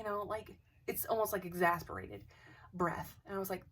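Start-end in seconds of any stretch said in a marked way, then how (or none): none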